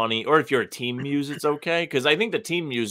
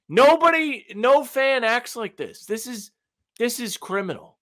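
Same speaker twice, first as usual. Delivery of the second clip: mock outrage; 9 semitones higher than usual. Mock outrage at fan tweet.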